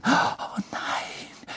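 a breathless, high frightened whisper; gasped whisper. A narrator's reading. Hermine gasping in fright at Lupin's change. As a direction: gasp it out in shock. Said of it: "japste Hermine".

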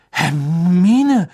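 loud, drawn-out name, rising high then falling; alarmed attempt to hold her back; zaghaft. A narrator's reading. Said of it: "sagte Ron zaghaft". (zaghaft mahnend, lang gezogen)

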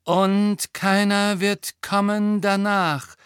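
slow, high, loud incantation; prophesying young woman's spirit. A narrator's reading. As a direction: intone it like a ghostly prophetess. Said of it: "sagte die Gestalt einer jungen Frau".

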